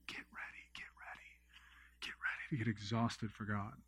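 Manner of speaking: hushed, whispered imitation of screaming; whispered mock scream. Preacher retelling a prophet's screamed warning, in a whispered mock scream. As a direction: mimic a scream under your breath, voice returning at the end. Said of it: "He literally was screaming".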